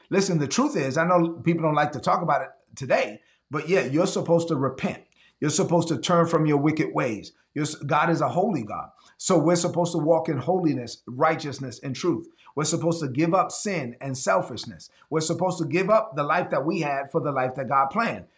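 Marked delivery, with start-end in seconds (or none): none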